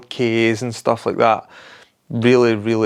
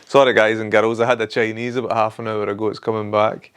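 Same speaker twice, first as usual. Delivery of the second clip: ordinary talk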